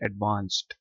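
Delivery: British "एडवांस्ड" with a long ah, ad-vahnst; British-accent "एडवांस्ड"; British pronunciation. Shown as a demonstration. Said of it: "British accent में इसको पढ़ेंगे".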